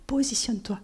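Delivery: the Holy Spirit's dry whispered command; a curt whispered order from the Spirit. Retold or imitated. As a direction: whisper it short and firm. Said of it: "C'était un peu sec".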